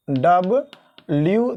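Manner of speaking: "डब्ल्यू" said very slowly, stretching the long u at the end; teaching "डब्ल्यू" with a drawn-out long u. Shown as a demonstration. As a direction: draw out the final u of "डब्ल्यू" slowly. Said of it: "डब्ल्यू दीर्घ उ है ना".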